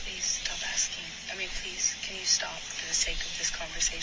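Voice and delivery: whispered, begging them to stop; a hushed plea in the middle of a fight; pleading whisper. Real, not acted. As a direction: drop to a desperate whisper. Partner pleads mid-argument.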